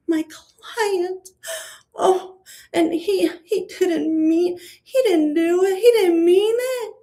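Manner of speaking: high, whiny fake crying; hammed-up sobbing pleading for her client; mock sobbing. Mocking the lawyer pleading for Brian, fake tearful whine.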